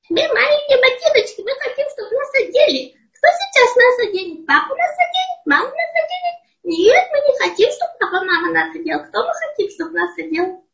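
very high squeaky little shoes; squeaky funny voice. Acted. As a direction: squeak like tiny talking shoes. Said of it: "Нужно пищать смешным голосом".

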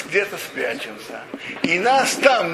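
ordinary talk, nothing marked

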